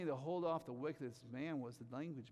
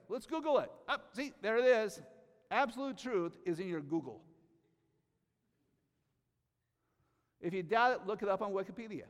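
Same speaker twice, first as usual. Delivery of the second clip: higher, louder, sarcastic rant; mocking; 7 semitones higher, 9 dB louder than usual. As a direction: ridicule blind faith in Google. Mocks trust in Google.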